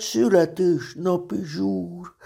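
high, drawn-out excited bear voice. A narrator's reading. Pooh to himself about the birthday party, excited. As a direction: savour the thrill in a high character voice. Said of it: "mondta Mici Mackó izgatottan".

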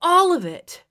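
high, frustrated retort. A narrator's reading. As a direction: shoot back in frustration. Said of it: "he shot back with frustration".

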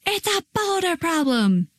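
put-on high, strained kid's wail at ordinary volume; mock meltdown scream. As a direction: act out a child melting down. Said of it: "they need to just scream and shout".

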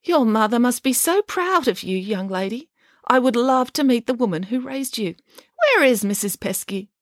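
put-on high, syrupy old lady voice; a theatrical, patronising dame; gushing grand dame. Dame Bronwyn addressing the crowd.

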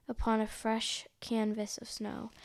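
usual speaking voice

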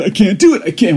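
high, loud, overwhelmed wail; mock panic. Podcaster likening anxious adults to his daughter, mock-panicked whine. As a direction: throw a little kid's helpless fit, hammed-up. Adults having a third grade moment.

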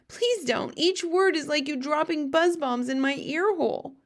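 high-pitched, pained begging; pleading cry. A narrator's reading. BFG begs Sophie to spare his ear, pleading cry. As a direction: plead with the pitch pushed right up. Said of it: "cried the poor B F G".

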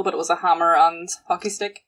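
normal talking voice

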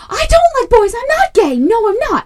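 put-on high, loud protesting boy; defensive kid. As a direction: insist loudly, voice shooting up. Son gets defensive.

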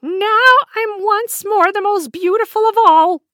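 high, loud triumphant witch voice; gloating queen. A narrator's reading. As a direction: crow over her victory. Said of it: "thought the old woman to herself".

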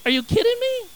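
very high, exasperated disbelief; incredulous outburst. Real, not acted. Preacher scoffing at Peter fearing a servant girl, incredulous. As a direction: pitch it way up, scandalised.

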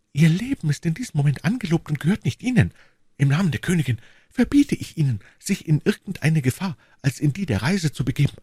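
high, urgent female character voice; imploring woman. A narrator's reading. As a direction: forbid him urgently in a lifted woman's voice. Voices Madame Bonacieux, forbidding. (hohe, eindringliche Frauenstimme)

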